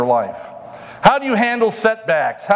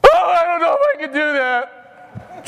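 high whiny doubting voice; mock whiny doubter; 9 semitones higher than usual. Preacher mocking a doubter's excuse, high whine.

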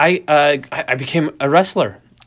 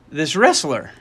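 ordinary delivery

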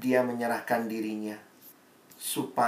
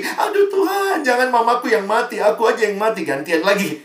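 high, hammed-up wail of the child; mock crying child; 8 semitones higher, 8 dB louder than usual. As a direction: overplay a child begging God. Revived child pleads, comic. (anak merengek memohon kepada Tuhan, dibuat-buat)

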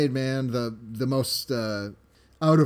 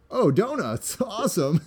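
delighted; 7 semitones higher than usual. Retold or imitated. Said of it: "and thinks".

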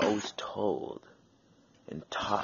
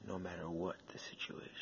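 whispered aside; 3 semitones lower, 12 dB quieter, faster than usual. Real, not acted. Quiet closing line.

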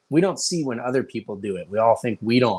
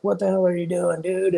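lightly voiced irate American, pitched higher; mock indignation; 7 semitones higher than usual. American driver confronts the other after an accident, mock indignation. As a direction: raise the pitch in exasperated disbelief.

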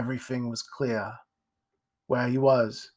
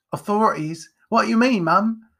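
higher, louder startled lad; alarmed boy; 9 semitones higher, 6 dB louder than usual. Acted. Jack asking his mum, suddenly alarmed. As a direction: jump up in alarm.

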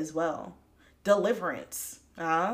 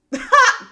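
sudden loud high burst of laughter; real laugh bursting out; 14 dB louder than usual. Real, not acted. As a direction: crack up loudly. Laughs at her own story.

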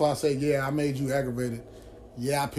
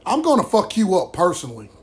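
furious threat; 4 semitones higher, 6 dB louder than usual. Real, not acted. Threatening the brother on camera.

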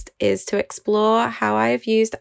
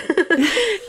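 high delighted laugh; 10 semitones higher than usual. Real, not acted. Laughing at daughter's plane trip.

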